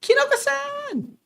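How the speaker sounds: very high, slow drawn-out cry; desperate call. Acted. Friend crying out to the attacked woman, high and drawn. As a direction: call out in alarm. Calling out to the victim.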